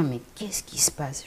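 whispered thought. Retold or imitated. Whispered inner thought retold.

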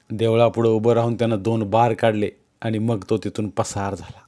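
usual speaking voice

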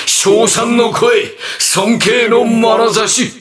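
high, slow theatrical proclamation; rapturous oratory. Acted. Villain tempting heroes with praise, high grandiose voice. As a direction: swell upward, savouring each phrase.

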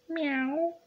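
very high, thin meow; cat's cry. A narrator's reading. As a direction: mimic a cat meowing. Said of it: "cried Pe-, Pilchard".

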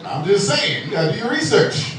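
ordinary delivery

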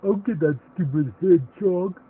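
loud, shifted villain growl; Growler voice. Acted. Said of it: "here's my Growler voice".

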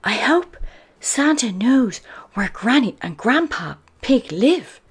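put-on high little-girl piglet voice; Peppa. A narrator's reading. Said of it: "Peppa whispers before she falls asleep".

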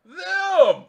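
screaming, high and loud, full rage; furious royal shout. Acted. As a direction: bellow the execution order like a mad queen. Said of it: "It's like".

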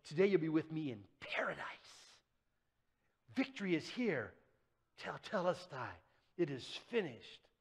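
soft, quick whisper; hushed promise. Acted. Jesus on the cross promising the thief paradise, whispered.

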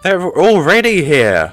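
loud, high cry of alarm; alarmed shout. Real, not acted. Player warning game allies that enemies arrived, alarmed shout.

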